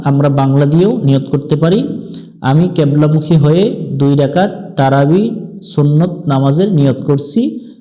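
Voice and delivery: ordinary talk, nothing marked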